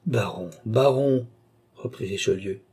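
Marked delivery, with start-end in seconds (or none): 0.1-1.3 grave warning. Acted. Duke warns the baron gravely.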